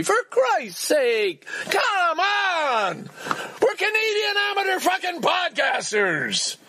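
high-pitched exasperated shouting; overreacting outburst. Real, not acted. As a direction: plead at the top of your range, drawing out the words. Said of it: "that is overreacting".

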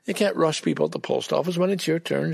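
ordinary talk

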